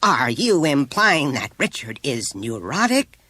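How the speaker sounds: chilled. A narrator's reading. Mother offended by the probe suggestion, chilled. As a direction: demand it icily, with well-bred contempt. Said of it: "in a chilled voice".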